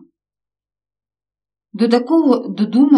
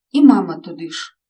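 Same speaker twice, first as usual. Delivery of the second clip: ordinary delivery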